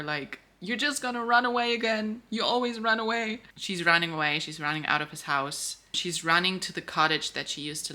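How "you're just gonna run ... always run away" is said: higher, lightly acted hurt yell; mock yell. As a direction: throw it after her as she runs, half-acted. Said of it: "He yells after her".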